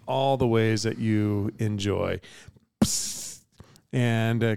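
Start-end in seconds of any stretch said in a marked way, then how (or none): 2.8-3.4 soft hissed spurt noise; imitated blood spurt. Game master sounding the blood from an axe wound, soft imitated spurt. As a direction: make a quiet spraying hiss.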